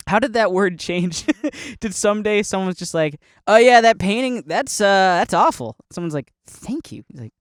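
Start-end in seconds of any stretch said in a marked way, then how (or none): 3.5-5.8 loud, high-pitched verdict; mock critique. Viewer judging a painting, loud mock critique. Someone insults a painting, acted. 6.5-7.0 high, soft thanks; mock flattered. Painter mistaking insult for compliment, high soft mock thanks.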